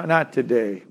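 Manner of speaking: low, quiet, half-hearted tired mumbling; tired churchgoer excuse. Acted. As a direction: act out a reluctant son begging off. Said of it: "you're gonna think".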